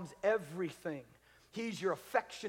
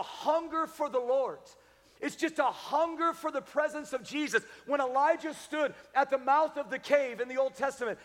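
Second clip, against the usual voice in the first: raised, driving sermon voice; fervent preaching; 7 semitones higher, 5 dB louder than usual. Real, not acted. Preacher telling congregation of Elijah at the cave, fervent preaching. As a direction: preach it high and insistent.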